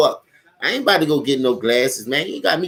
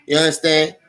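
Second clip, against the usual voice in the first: ordinary delivery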